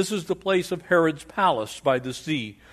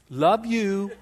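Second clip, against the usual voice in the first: fawning flatterer; 5 semitones higher than usual. Retold or imitated. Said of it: "he's like".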